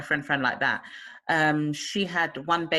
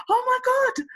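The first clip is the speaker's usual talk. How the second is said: high, louder cry of relief; thrilled mother; 4 dB louder than usual. Retold or imitated. Friend retelling her caesarean, high joyful cry. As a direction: squeal it out with joy. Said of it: "and she was like".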